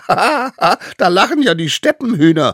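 high, loud scoffing cackle; mocking laughter. A narrator's reading. Smallest savage scoffs at the threat, mocking. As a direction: laugh shrilly, then jeer in child voice. Child mocks the court's threat.

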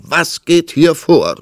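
loud, high, slow exclamation; calling out. A narrator's reading. King storming in on the crying queen, calling out. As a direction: demand it at full volume like a king. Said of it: "rief er aus".

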